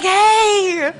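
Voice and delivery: loud, high, drawn-out hey; little party guy. Acted. Party guy coming back a minute later, high shouted call. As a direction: burst back in as the shrieky little pest. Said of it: "he'd come back".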